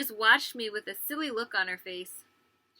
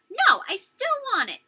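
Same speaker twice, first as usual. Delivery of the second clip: put-on high, loud childish protest; indignant refusal; 12 semitones higher, 10 dB louder than usual. Girl refusing to give her sister the dress, indignant refusal. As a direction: insist like an upset little sister.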